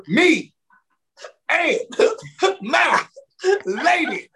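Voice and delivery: "Me" "and" "my" "lady" as separate words with pauses, laughing between; spelled out slowly. Retold or imitated. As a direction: spell out "Me and my lady" word by word like to a slow listener. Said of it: "Do I gotta spell this out for you?".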